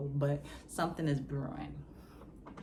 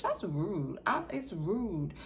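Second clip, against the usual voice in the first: usual speaking voice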